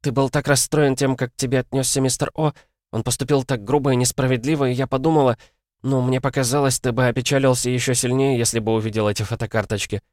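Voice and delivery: put-on high, rushed guilty girl; flustered chatter. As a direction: babble it out in a flustered rush. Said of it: "сбивчиво затараторила".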